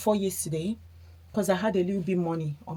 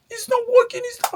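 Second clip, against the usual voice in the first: put-on high, loud whiny child's voice, far higher; upset son; 7 dB louder than usual. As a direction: mimic a frustrated kid, pitched way up. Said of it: "And he was this upset".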